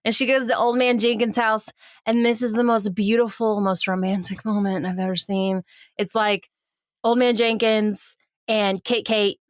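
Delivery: ordinary delivery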